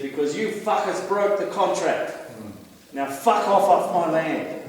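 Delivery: quoting the king's anger. Retold or imitated. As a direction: fire it back at them, imitating the king. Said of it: "I'm gonna quote him".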